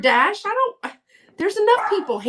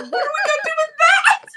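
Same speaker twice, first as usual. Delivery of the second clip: laughing disbelief; faster than usual. Retold or imitated. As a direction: squeal it like you can't believe the cane. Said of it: "I was like".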